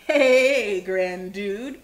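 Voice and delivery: loud, stretched hail on the first word; cowboy's hearty holler, easing off; calling out. A narrator's reading. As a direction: call it across the valley. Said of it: "called out the cowboy".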